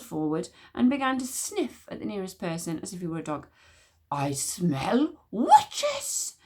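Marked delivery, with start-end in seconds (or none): 4.1-6.4 very slow, drawn-out sniffing hiss; high squeaky hiss. Acted. Witch smeller sniffing the crowd, squeaky slow hiss. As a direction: stretch every word like a weasel. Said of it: "hissed ... in a high, squeaky voice".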